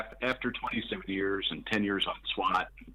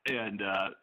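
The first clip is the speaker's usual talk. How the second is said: ordinary talk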